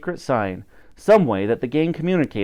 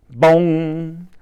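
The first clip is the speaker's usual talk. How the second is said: louder, voiced bell toll; clock bong; 3 semitones higher, 4 dB louder than usual. A narrator's reading. Narrator voicing Big Ben striking, a loud booming bong.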